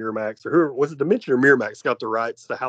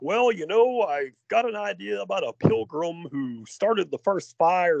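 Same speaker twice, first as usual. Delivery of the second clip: higher-pitched mock pitch of an idea; Ari Aster; 7 semitones higher than usual. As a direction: lightly act a director floating an idea, pitched up. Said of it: "Ari Aster, do you have any new ideas".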